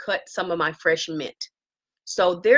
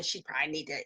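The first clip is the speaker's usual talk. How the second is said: pious inner thought; 15 dB quieter, much faster than usual. Retold or imitated. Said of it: "all pious and holy".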